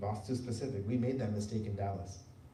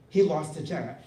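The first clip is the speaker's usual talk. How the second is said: usual speaking voice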